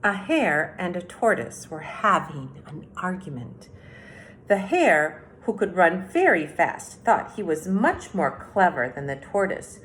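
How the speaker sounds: storybook read-aloud, unhurried and clear; reading to children. A narrator's reading. Teacher reading The Tortoise and the Hare to third graders, storytelling. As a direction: read it like story time on the rug. Reads fable aloud to class.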